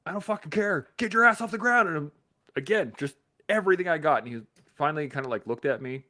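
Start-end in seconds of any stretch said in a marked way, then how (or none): 0.1-1.9 higher, louder yelling order; angry instructor. Retold or imitated. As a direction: bark at the recruit to get up. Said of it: "And he's like".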